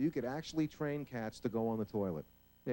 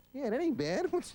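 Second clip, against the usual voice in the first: pleased dog voice; 12 semitones higher, slower than usual. Acted.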